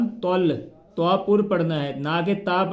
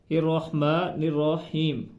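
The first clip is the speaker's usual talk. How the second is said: drawn-out slow reading of Rahman Rahim; slow recitation; much slower than usual. Shown as a demonstration. Teacher reciting slowly for students. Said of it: "रा को हा के साथ मिलाना है".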